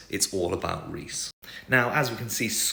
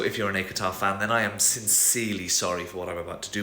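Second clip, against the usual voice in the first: ordinary talk, nothing marked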